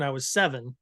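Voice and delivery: usual speaking voice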